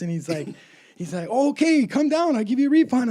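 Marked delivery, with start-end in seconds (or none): 1.3-3.1 placating victim. Retold or imitated. Prank victim placating the callers.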